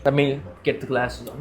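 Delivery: ordinary talk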